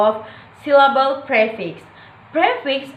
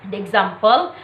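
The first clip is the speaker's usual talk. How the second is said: usual speaking voice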